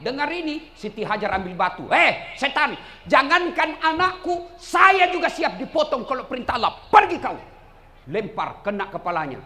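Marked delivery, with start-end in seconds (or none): 1.9-7.4 loud, raised and defiant; defiant shout. Acted. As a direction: stand up to the devil, loud. Hajar melawan setan. (seruan lantang menantang setan)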